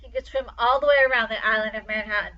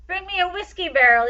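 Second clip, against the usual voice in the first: higher, loud urgent call; 7 semitones higher, 6 dB louder than usual. A narrator's reading. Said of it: "he cried".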